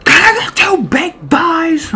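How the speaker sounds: high, loud, crying yell of a man losing it; crazed angry shout. Retold or imitated. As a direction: scream the order like he's snapping. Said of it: "he was just going crazy".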